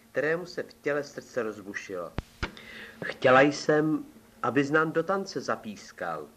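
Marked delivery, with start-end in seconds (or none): none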